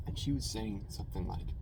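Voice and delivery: ordinary delivery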